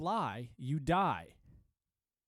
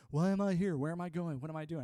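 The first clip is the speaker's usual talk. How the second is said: raised-pitch existential questions, lightly hammed up; mock mystical questioning; 5 semitones higher, 4 dB louder, faster than usual. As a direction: ask life's big questions in mock wonder. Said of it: "That's a very mystical question".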